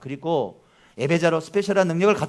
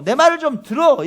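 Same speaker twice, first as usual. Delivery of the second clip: louder, higher voice of God calling his people; preacher voicing God's plea, louder; God's urgent call; 8 semitones higher, 8 dB louder than usual. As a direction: call out as God, urging them to listen. Preacher voices God pleading again.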